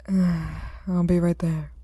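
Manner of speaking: very slow, tired answer; exhausted detective answering the officer; weary reply. Acted. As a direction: drag it out with fatigue.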